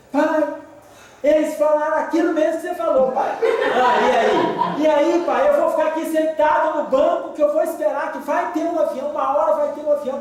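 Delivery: daughter's voice. Retold or imitated. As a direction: play the daughter reporting back. Daughter reports back by phone.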